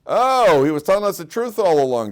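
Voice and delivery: loud, high "oh", then easing down; mock sudden realization. Pharaoh's court learns he was truthful all along, mock sudden realization. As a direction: act out a relieved discovery, lightly hammed up.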